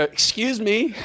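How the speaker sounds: high-pitched polite hail; calling out politely. Retold or imitated. Driver hailing a woman from the car window, high call. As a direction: pitch it up to catch a stranger's attention.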